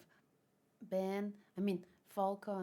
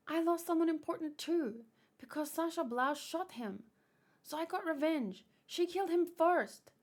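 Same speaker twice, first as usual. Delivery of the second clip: higher, a lightly voiced defiant girl; girl's defiant outburst; 7 semitones higher, 5 dB louder than usual. Girl defending her revenge to the man, defiant. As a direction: insist she was right, rising in pitch.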